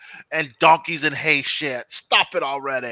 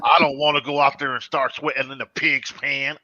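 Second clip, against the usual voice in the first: ordinary talk, nothing marked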